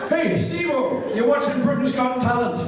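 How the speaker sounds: chirpy mimicry of a mate calling; imitating Davey. Voicing Davey on the phone.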